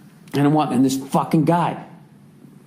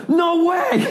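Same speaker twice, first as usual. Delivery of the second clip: much higher, starstruck shout; excited yell. Retold or imitated. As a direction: yelp it like a stunned fan. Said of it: "I literally yelled".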